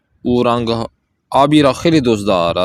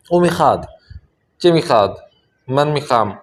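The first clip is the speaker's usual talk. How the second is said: ordinary talk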